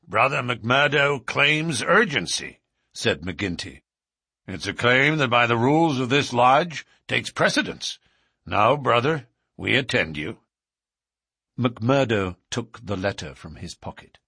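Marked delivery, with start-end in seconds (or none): 0.1-2.6 raised, proclaiming chairman's voice; lodge chairman. Acted. Chairman announces a claim to the lodge, high proclamation. 4.5-10.4 raised, proclaiming chairman's voice; lodge chairman. Acted. Chairman announces a claim to the lodge, high proclamation.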